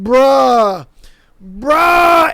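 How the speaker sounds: loud exasperated bruh; real loud frustration. Real, not acted. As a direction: yell it in disbelief at the screen. Reacting in disbelief.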